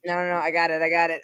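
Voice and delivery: usual speaking voice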